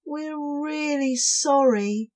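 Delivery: high, apologetic voice; sorry cobwobblies. Acted. Cobwobblies apologising to the wrong snail. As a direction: apologise sincerely.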